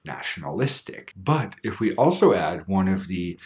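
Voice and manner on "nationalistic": "nationalistic" with main stress moved to LIS; lower-pitched, softer "nationalistic", leaning on LIS; shifted stress. Shown as a demonstration. Teacher showing the change, "nationalistic" stressed on LIS. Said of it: "the stress will change".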